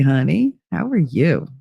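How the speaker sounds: usual speaking voice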